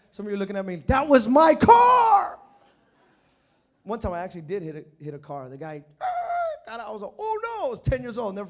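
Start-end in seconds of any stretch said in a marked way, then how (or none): 0.9-2.4 huge, high, drawn-out yell; mock outraged shout. Congregant's mock angry outcry. 7.2-7.7 put-on high, loud kid's panic; retold alarm. Kid realizing he hit car.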